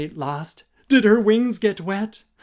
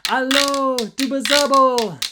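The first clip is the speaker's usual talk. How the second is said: higher, louder call for help; crying out; 7 semitones higher, 5 dB louder than usual. Acted. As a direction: cry out across the sea. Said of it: "The jazz fly cried".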